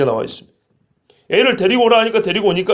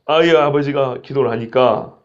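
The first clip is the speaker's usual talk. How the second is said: ordinary talk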